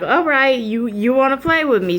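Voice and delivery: high, loud challenge; mock defiance toward mom; defiant. Daring mom back.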